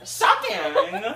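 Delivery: high, loud pearl-clutching; mock outrage. Joking about a character's filthy language, mock-scandalised.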